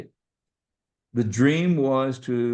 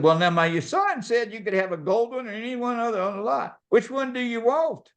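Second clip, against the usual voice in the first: high-pitched imitation of a pushy salesman; eager car salesman; 10 semitones higher than usual. Salesman offering dad any car on the lot, eager. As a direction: sing out the offer, voice way up. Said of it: "And he said".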